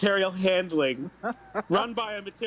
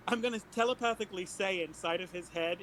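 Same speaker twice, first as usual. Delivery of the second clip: normal talking voice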